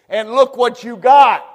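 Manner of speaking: loud, fiery pulpit tirade; preaching at full force. Acted. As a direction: thunder it at them from the pulpit. Preacher rails at the congregation.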